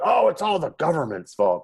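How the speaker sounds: high, loud whiny mimicry; whiny mock complaint. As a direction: mimic a griping know-it-all. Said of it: "some people are like".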